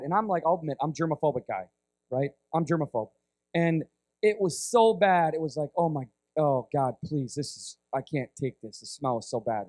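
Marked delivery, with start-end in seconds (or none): 5.8-9.7 low, softened mock plea; low, muted retold revulsion; mild disgust. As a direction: quietly groan out a plea, overcome by stench. Retelling his disgust at the smell.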